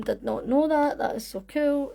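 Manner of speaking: ordinary talk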